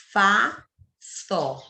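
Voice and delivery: two separate syllables, FA, SÓ, with a pause between; read with pauses. Shown as a demonstration. Teacher showing pupils how to read pausadamente, FA and SÓ split apart. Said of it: "leia pausadamente essa palavra".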